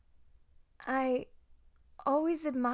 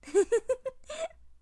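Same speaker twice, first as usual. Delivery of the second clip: shy giggle; 12 semitones higher, 4 dB louder than usual. Acted. Giggles admitting she loves droids.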